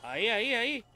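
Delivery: loud high cheer; excited cheering. Real, not acted. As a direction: egg yourself on in a fight.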